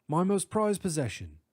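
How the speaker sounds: proud English scholar; British accent. Acted. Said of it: "distinct British accent".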